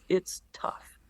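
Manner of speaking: low hushed whisper. Real, not acted. Pastor admitting how hard it is, hushed whisper. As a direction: breathe it out softly like a confession. Somber admission about hard times.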